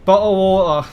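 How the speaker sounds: "Bottle of water" in Southern English with no T's, "bo'le of wa'er"; Southern English accent. Shown as a demonstration. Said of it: "British accent is just equals remove all the T pronunciations".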